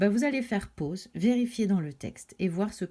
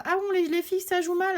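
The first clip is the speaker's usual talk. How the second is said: aiguë, étonnement de maman; maman étonnée; 9 semitones higher, faster than usual. Acted. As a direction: fais la maman surprise. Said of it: "et puis elle dit". (maman surprise que les garçons se moquent)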